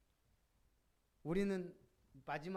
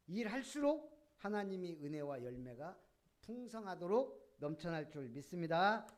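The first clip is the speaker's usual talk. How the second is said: ordinary talk, nothing marked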